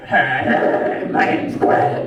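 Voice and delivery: loud, low macho Greg with w; buff guy voice. Retold or imitated. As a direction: puff up like a gym bro. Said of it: "got really buff".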